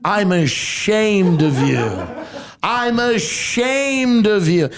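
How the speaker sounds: hammed-up, stretched-out grandmotherly shaming; grandmother's repeated shaming. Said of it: "She just kept saying that".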